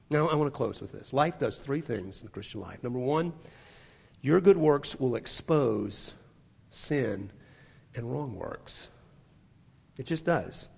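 ordinary talk

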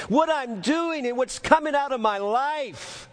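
high-pitched, impassioned owning up; earnest confession. Acted. Contrite heart admitting fault to God, impassioned confession. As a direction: confess it fervently, voice lifted high. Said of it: "a heart that says".

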